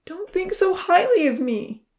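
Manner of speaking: high, slow, pained plea; grieving plea. A narrator's reading. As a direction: beg them not to admire you. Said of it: "he grieved".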